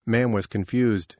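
usual speaking voice

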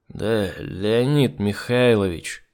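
put-on slow, slurring drunk words; tongue tripping, very drunk; slurred drunk speech. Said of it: "заплетающимся языком заявил Сергей".

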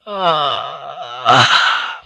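contented ah of pleasure; blissful sigh. A narrator's reading. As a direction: sink in with a happy sigh. Sinking into a perfect bath.

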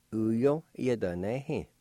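"Uyo yadanehi" blended slowly; careful teacher model of "Uyo yadanehi"; slow full word. Shown as a demonstration. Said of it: "pronounced like this".

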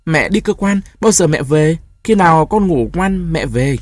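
giọng trẻ con cao hẳn, nhanh, mếu máo; giả giọng bé con sắp khóc hỏi mẹ; bé gái mếu máo. Acted. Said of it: "mếu máo". (bé gái hỏi bố mẹ đi đâu, giọng cao mếu máo)

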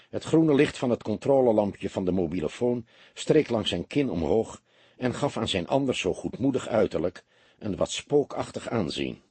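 ordinary delivery, nothing marked

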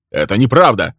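sharp, emphatic objection; indignant denial. Acted. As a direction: burst in to deny the charge. Heroine bursts out in denial.

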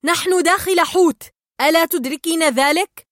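loud scolding in disbelief; angry shout. A narrator's reading. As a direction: shout as your temper starts to take over. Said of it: "فصاح مارلين وقد بدأ الغضب يتملكه".

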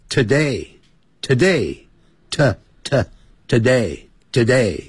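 "today" repeated slowly, stressing the t; slow t drill. Shown as a demonstration. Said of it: "叫today".

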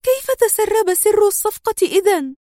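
high-pitched baffled question; perplexed high character voice; puzzled. A narrator's reading. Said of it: "في حيرة وهي تغمغم".

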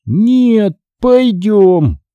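high, drawn-out stubborn insistence; stubborn zombie son. A narrator's reading. Son stubbornly contradicts his father, high zombie drawl. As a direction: pitch it up and stretch each word. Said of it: "упрямо повторил Натан".

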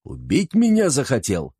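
furious high-pitched yell; shouting. Acted. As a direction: bellow at the servant. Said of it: "заорал".

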